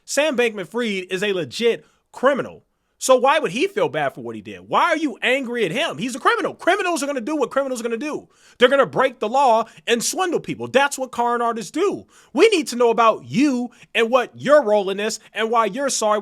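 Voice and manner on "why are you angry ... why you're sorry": heated rant. Real, not acted. Ranting at promoter's excuses.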